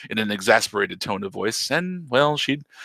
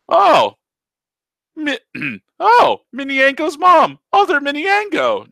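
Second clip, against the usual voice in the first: high surprised voice; 8 dB louder than usual. Acted. Voices Taco.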